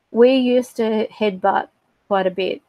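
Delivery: usual speaking voice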